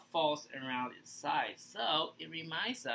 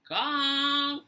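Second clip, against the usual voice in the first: calling out; 11 semitones higher, 8 dB louder than usual. Real, not acted. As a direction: call out loudly. Host calls out for the puppet.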